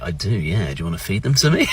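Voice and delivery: soft, low murmur; bashful flirting reply. Acted. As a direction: play the smitten customer, hushed. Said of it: "I was like".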